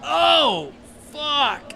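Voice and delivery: high, loud, drawn-out exclamation; appalled. Acted. Thug looks inside the snatched bag, appalled. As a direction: recoil in horrified disgust. Thug recoils from bag's contents.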